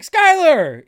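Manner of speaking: high, loud call of a name across the street. Retold or imitated. Driver spots an old friend and calls out to him loudly. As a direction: shout to catch his attention. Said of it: "I was like".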